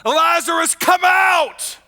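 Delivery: high, loud commanding cry; loud shout. Acted. Jesus calling into the tomb, loud commanding shout. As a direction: call him out of the tomb at full voice. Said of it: "he shouted with a loud voice".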